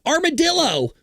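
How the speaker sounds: high-pitched, drawn-out mock shout; demonstrative shout. Shown as a demonstration. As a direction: shout a random word for show. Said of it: "I could shout anything I want".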